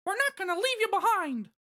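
very high squeaky falsetto; Mickey Mouse voice. Acted. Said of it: "That was Mickey".

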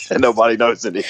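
words coming through a laugh; amused, laughing. Real, not acted. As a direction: chuckle along while saying it. Amused by partner's fake language.

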